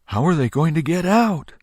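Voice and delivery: put-on high, curious child voice; a child puzzling aloud; worried little boy. A narrator's reading. Little boy asking the crowd, high and curious. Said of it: "the little boy said".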